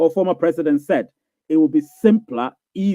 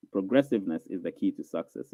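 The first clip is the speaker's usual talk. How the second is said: much softer and lower, like an echo; quiet echoing repeat; 8 semitones lower, 10 dB quieter than usual. Shown as a demonstration. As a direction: drop it down to a hushed repeat.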